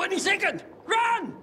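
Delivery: sudden panicked cry of warning; urgent shout. Acted. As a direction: scream at him to get away. Bomb about to blow.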